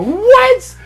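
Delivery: high, loud yelped question; incredulous outburst. Retold or imitated. Waiter retelling their reply to a complaining guest, incredulous outburst. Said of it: "Just like that".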